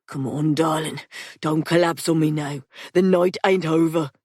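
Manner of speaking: lightly voiced rough Londoner, urging; gruff rough man. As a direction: coax her up, rough but caring. Patrick's voiced lines.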